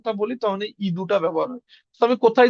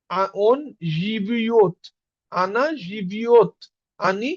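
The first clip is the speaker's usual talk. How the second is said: জিবিওত stretched out very slowly with a clear ইও; teacher drilling the verb forms at a crawl; slow demo pronunciation; much slower than usual. Shown as a demonstration. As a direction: model on জিবিওত and আনা জিবিওত slowly for learners. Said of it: "তো এটার উচ্চারণ জিবিওত".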